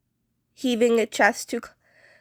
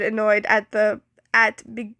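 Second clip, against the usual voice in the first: usual speaking voice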